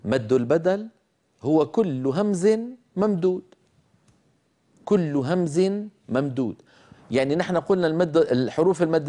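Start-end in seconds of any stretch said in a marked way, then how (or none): 1.4-6.6 كل همز ممدود, each word weighted; slow emphatic rule. Shown as a demonstration. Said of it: "اسمعوا مني هذه الكليمات".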